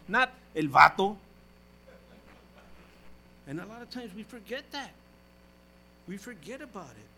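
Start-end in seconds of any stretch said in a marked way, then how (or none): 0.1-1.2 loud, fervent preaching to the congregation; full-force preaching. Acted. Pastor exhorting the congregation in a loud, fervent preaching voice. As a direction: preach it out hard to the pews.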